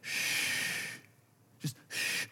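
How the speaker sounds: soft, voiceless shush; hushing shh. Retold or imitated. Dad to his upset five-year-old, hushing. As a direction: shush a child softly. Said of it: "and I say to her".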